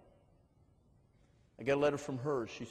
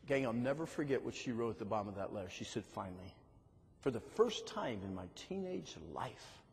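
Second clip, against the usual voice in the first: soft, low, slowing finish; preacher quietly reading the girl's letter; tender closing; 3 semitones lower, 5 dB quieter than usual. Acted. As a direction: let the last words land gently. Tender close of the story.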